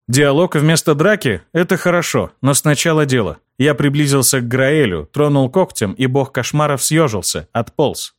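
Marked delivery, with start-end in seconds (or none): none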